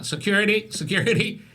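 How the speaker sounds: high, alarmed calling for security; mock call for security. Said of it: "and they were going".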